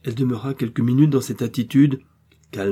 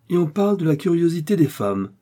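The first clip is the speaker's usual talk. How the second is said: higher, louder scornful exclamation; contemptuous outcry, higher pitched; disdainful cry; 8 semitones higher, 4 dB louder than usual. A narrator's reading. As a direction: scoff at her out loud. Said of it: "s'écria-t-il avec dédain".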